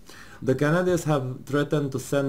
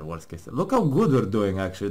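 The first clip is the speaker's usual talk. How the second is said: ordinary talk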